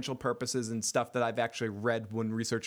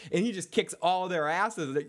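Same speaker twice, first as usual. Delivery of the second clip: ordinary delivery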